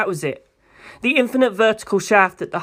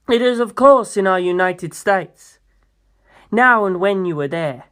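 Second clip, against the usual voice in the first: smooth president; 2 semitones higher than usual. Acted. Funny Valentine explaining the plan to Pucci, stately and knowing. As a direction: lecture him calmly as if already victorious.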